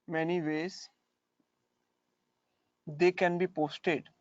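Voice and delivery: slow, deliberate dictation; dictating a question. Shown as a demonstration. Teacher dictating the letters question, slow. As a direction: say it slowly for them to copy.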